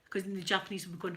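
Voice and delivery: ordinary talk, nothing marked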